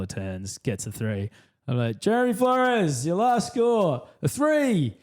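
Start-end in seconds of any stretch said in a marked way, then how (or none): 2.0-5.0 high, slow triumphant call; high slow gloating commentary; gloating announcer. Retold or imitated. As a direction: announce it with glee. Gleeful commentary call on mic.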